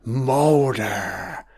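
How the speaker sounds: soft, faraway call of a name; a hushed ghostly woman calling out; eerie female voice. Retold or imitated. Imitating the mysterious woman's voice calling Mulder, softly. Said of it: "a female voice that was saying".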